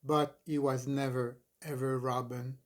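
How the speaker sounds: plain reading voice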